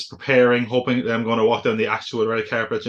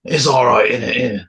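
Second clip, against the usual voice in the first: put-on Essex accent; 4 dB louder than usual. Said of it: "how's your Essex accent?".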